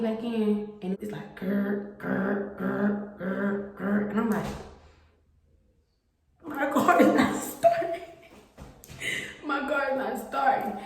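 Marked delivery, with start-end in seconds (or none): 1.4-4.1 mock engine failing to turn over; engine grinding. Imitating car failing to start. 6.5-10.8 high, laughing despair; laughing in disbelief as it stalls again; high half-laughing wail; panicked laugh. Retold or imitated. Car stalls at service lane.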